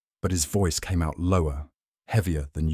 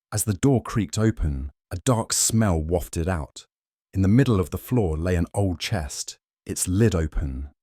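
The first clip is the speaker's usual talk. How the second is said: ordinary delivery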